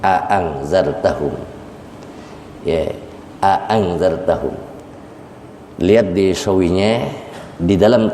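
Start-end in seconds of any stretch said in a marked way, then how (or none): 0.0-4.5 both hamzahs of "A angzartahum" sounded fully; tahqiq. Shown as a demonstration. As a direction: read "A angzartahum" with two separate, distinct glottal onsets. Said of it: "dua-duanya dibaca, di tahqiq itu artinya dibaca".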